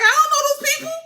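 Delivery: high, loud, put-on voice for Helena refusing; mocking high voice of a queen who doesn't want to go. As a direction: pitch it way up and push back like an upset young queen. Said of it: "And she was like".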